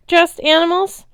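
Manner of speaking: high, loud incredulous question; outraged echo. A narrator's reading. Satyr outraged at the rancher, high incredulous cry. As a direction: throw his words back.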